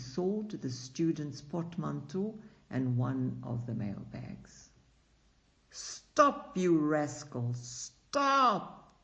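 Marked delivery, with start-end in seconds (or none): 5.7-9.0 high, loud shout from afar; thrown-out postman shouting after the cart. A narrator's reading. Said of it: "they heard him shout".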